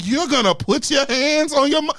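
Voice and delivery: high, loud indignant accusation; incredulous scolding, hammed up; mock outraged mom. Mother's outrage voiced in story.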